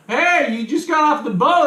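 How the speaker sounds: high-pitched mocking call, laughing; mock heckler. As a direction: call out like a teasing passer-by. Imitates passers-by mocking the hat.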